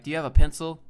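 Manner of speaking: loud, plain American question; American phrasing. Acted. Streamer showing chat how Americans ask, loud American. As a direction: ask it loudly in an everyday American way. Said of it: "in the U S, you'd be like".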